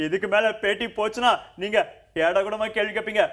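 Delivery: ordinary talk, nothing marked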